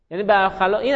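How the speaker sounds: normal talking voice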